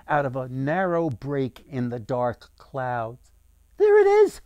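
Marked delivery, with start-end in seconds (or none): 3.8-4.4 put-on very high, loud child's cry; young girl's yell. A narrator's reading. Young girl spots the sun in the west, high yell. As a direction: squeal it out like an excited little girl. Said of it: "yelled a young girl".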